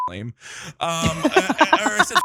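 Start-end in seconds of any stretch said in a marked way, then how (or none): none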